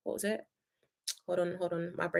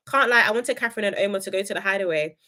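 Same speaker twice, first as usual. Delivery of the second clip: usual speaking voice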